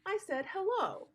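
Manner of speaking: "I said hello." starting high and falling down on "hello"; falling answer tune. Shown as a demonstration. Teacher shows kids an answer tone going down, falling. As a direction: let "hello" drop to a settled answer. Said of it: "my tone might go down like".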